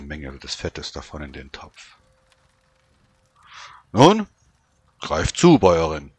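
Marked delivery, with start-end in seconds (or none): 3.9-6.1 soldier voice. Acted. Soldier invites her to eat.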